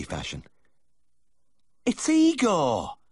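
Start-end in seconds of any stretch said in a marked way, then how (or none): 1.9-3.0 high, loud, drawn-out cry of recognition; farmer's wife's astonished shriek; shocked gasp. A narrator's reading. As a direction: gasp it out as a high-pitched exclamation. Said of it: "she gasped".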